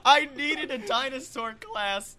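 high, loud comic outcry; hammed-up longing at full voice; impassioned wail. Real, not acted. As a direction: shout it like a desperate plea. Confesses craving in comic despair.

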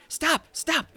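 high panicked stop; yelling. Acted. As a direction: cry out for help. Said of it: "Both of them are yelling".

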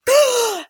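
sharp high gasp for breath; gasp for air. Retold or imitated. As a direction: suck in air like surfacing from water. Coming up like a diver.

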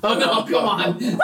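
ordinary talk, nothing marked

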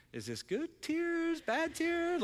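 high, slow puzzled question; mock puzzled. Pastor retelling his worry over the girl's tears, puzzled. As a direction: act out the confusion, drawn out and high. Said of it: "I'm like".

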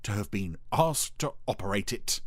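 slow, self-important boast; puffed-up pride, easing off at the end; proud Dougal. A narrator's reading. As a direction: draw it out proudly, hesitating mid-phrase. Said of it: "he said proudly".

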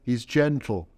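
ordinary talk

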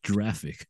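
"giraffic" said with a soft j sound for the g; mock mispronunciation of graphic. Shown as a demonstration. Host mocking the soft-g argument, "giraffic" with a j sound. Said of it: "graphic is not pronounced giraffic".

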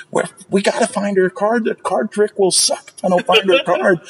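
high-pitched, mock-desperate outburst; snapped magician, retold. Magician searching diners' purses for her card, mock desperate. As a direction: imitate a performer frantically losing it. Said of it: "he just snapped".

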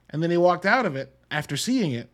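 usual speaking voice

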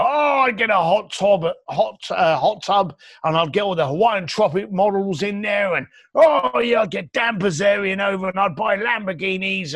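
imitating someone carried away with excitement; mock excited. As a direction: blurt out a knee-jerk fantasy. Acts out knee-jerk lottery winner fantasy.

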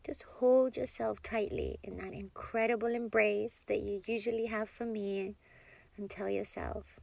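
ordinary delivery

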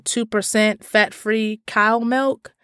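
normal talking voice

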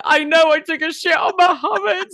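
high, loud, slow laughing shout; laughing confession. Real, not acted. Confessing to the nurse, loud laughing shout. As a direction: blurt it out between laughs.

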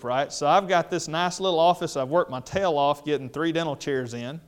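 ordinary talk, nothing marked

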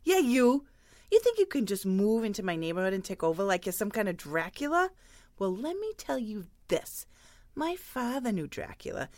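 swelling mock-tough neighborhood scold; hammed-up tough lady telling him off; mock confrontation. Patty chewing out the goth customer, mock-tough.

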